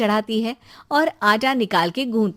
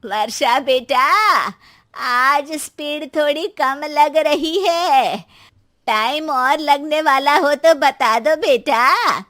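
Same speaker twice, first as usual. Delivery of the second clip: louder, slow call from another room; calling from outside; 5 semitones higher, 7 dB louder, slower than usual. Acted. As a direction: call out to the kitchen, drawn out. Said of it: "सास बाहर से आवाज देती है".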